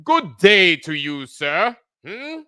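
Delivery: put-on slow, drawn-out mid-Atlantic accent greeting; an unhurried mid-Atlantic accent with a change of voice; mock mid-Atlantic accent. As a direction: lean into a haughty mid-Atlantic accent, slowly. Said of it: "with their mid-Atlantic accents".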